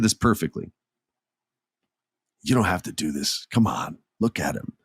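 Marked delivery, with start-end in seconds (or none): 2.4-4.7 softer, lower gentle plea; sweet and tender. Retold or imitated. Sami begging his friend to drop the chair, soft tender coaxing. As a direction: quietly coax a friend to stop. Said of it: "he plays it so sweet".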